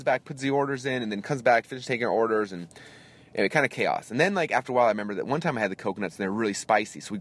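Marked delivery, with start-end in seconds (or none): none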